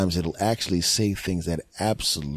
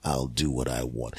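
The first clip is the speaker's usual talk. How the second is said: low, hissed rasp; a nasty whispered sneer from deep down; reptilian brain; 9 semitones lower, 5 dB quieter, slower than usual. Retold or imitated. His reptilian brain telling the mammalian brain off, whispered. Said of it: "And then reptilian brain says".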